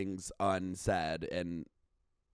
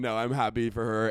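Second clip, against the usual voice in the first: ordinary talk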